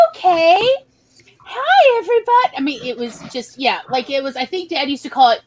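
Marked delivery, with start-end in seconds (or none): 0.1-2.6 put-on much higher, loud sweet kid voice; wide-eyed innocent kids. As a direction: play the innocent child at the border. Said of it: "we were like".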